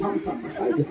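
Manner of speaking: breathless whispered stop stop; pleading whisper; whispered plea. Acted. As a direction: hush her urgently under breath. Husband's beard pulled awake.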